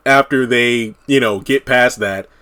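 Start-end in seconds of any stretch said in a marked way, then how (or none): none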